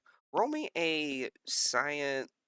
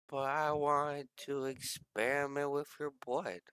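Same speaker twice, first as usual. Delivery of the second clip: small, higher, soft pout; sad pleading; 4 semitones higher, 5 dB quieter than usual. Acted. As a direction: plead softly like a disappointed little scientist. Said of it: "looks at you very sad".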